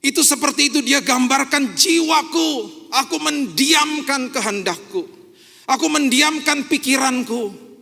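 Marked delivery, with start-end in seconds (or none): 2.9-7.7 slow fervent declaration. Acted. Preacher stills his own soul.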